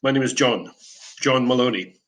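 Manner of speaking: ordinary delivery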